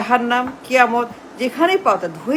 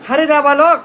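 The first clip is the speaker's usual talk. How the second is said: loud mocking taunt; scornful shout; 3 semitones higher, 6 dB louder than usual. Real, not acted. Orator scolding a rival.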